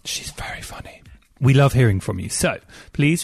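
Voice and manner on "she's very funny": a quick unvoiced whisper, as if sharing a secret; whispered aside. Real, not acted. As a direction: lean in and whisper it under your breath.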